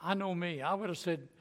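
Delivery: ordinary delivery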